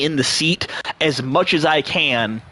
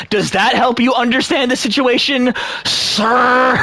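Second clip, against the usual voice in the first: acted scornful challenge, landing hard on "sir"; vitriolic; 7 semitones higher than usual. Passenger explaining himself to the angry ex, vitriolic. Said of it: "That sir had a lot of vitriol behind it".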